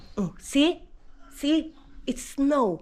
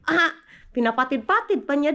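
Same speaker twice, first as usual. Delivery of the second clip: usual speaking voice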